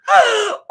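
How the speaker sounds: sharp, loud, high gasp of delight; excited gasp. Real, not acted. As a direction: gasp at the news. Reacting to reading summary.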